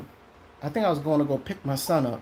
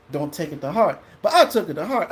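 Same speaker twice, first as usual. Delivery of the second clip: ordinary talk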